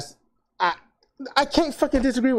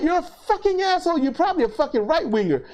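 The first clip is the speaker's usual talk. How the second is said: higher-pitched, hurried indignation; retold angry accusation; 8 semitones higher, faster than usual. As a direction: fire back at them, pitch up. Retold angry confrontation online.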